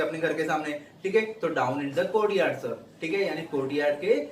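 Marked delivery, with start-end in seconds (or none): 1.5-2.7 slow read-aloud. Shown as a demonstration. Teacher repeats steward's reply.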